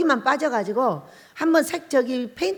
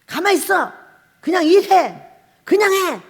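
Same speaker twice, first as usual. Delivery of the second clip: higher, louder snapping at a helper; bossy retort; 6 semitones higher, 8 dB louder than usual. Retold or imitated. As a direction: shoo the fussing deaconess away. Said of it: "막 이렇게 하는 거죠".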